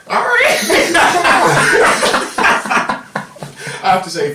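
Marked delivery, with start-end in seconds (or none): 0.1-3.8 very high, giggly attempt at a pterodactyl screech; pitiful pterodactyl screech. Shown as a demonstration. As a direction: try the pterodactyl cry and crack up laughing. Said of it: "that was a pitiful impression".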